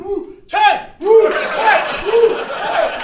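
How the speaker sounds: high, loud chanting cheer; screaming cheer. Retold or imitated. Coworkers cheering the boss, screaming chant. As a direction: cheer at the top of your lungs. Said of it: "We just started screaming".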